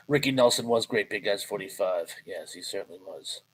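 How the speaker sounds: normal talking voice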